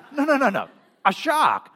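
high, loud, insistent mock yell; shouted correction. Husband on the beach correcting his surfing wife, insistent shout. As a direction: call out over the surf to correct her.

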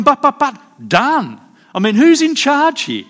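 high, loud sputtering objection; hammed-up indignant defendant; flustered protest. Preacher mimicking the young man protesting to the judge, high and flustered.

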